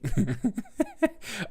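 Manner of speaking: soft high giggle. Real, not acted. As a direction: let a giggle slip out. Laughing at herself.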